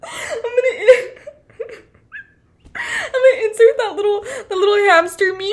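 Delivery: high, giggling, barely able to speak; real giggles breaking the words; laughing through words. Real, not acted. Cracking up at own joke.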